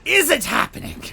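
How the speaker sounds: very high furious shout. A narrator's reading. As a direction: scream it in disbelief. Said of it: "Kecleta shouted".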